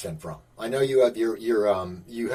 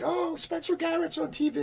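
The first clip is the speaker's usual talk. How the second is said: mock excited yell. Couple spotting an actor friend on TV, mock excited yell. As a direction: imitate an excited yell in a squeaky-high pitch. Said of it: "Joanne will yell".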